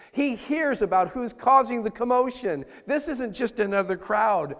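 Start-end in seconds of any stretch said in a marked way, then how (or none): none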